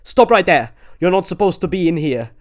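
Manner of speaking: high, loud panicky warning; loud startled Notch shouting; panicked shout. A narrator's reading. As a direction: yell it in panic. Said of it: "brandished sword in panic".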